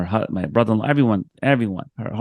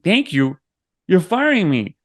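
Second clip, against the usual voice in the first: higher, incredulous retort; disbelief; 8 semitones higher, 5 dB louder, slower than usual. Retold or imitated. Answering the boss who fired him, higher and incredulous. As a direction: throw the words back in disbelief.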